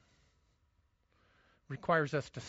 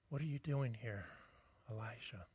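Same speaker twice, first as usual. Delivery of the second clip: hushed and much softer, falling away on the name; gentle whisper; 6 semitones lower, 11 dB quieter than usual. Acted. God to hiding Elijah in the cave, a gentle whisper. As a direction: lean in and whisper it like God calling softly. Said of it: "He's not shouting. It's a whisper".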